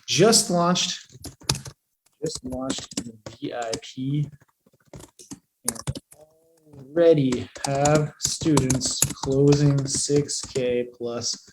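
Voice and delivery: very slow, broken by long pauses; halting, low dictation to oneself; composing aloud while typing. Real, not acted. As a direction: murmur it word by word as you type.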